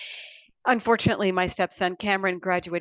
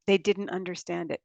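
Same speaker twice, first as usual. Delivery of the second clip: ordinary talk, nothing marked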